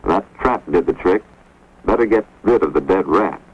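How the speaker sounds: usual speaking voice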